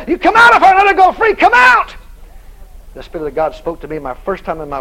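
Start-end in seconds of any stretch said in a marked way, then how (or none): 0.1-1.9 high, loud command to come out; commanding shout. Retold or imitated. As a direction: order the spirit out at full force. Preacher binds the devil.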